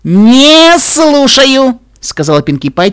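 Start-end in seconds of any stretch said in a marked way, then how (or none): 0.0-1.8 high, loud, drawn-out singsong; theatrical refusal. A narrator's reading. Pinkie refuses to hear secrets, theatrical. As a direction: stretch it out to drown someone out. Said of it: "театрально закрывая уши".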